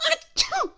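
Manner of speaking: high sudden sneezing sound; a quick comic sneeze. Acted. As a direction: sneeze the word out. Sneeze gag inside the list.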